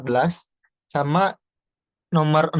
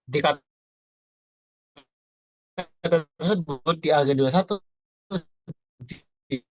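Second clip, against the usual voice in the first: voice breaking up; slower than usual. Real, not acted. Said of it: "suaranya putus-putus".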